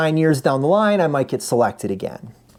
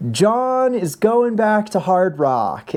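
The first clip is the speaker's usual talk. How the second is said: slow, drawn-out PA announcement; slow microphone announcement; 6 semitones higher, much slower than usual. Retold or imitated. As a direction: announce it slowly over a mic. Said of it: "gets on the microphone and says".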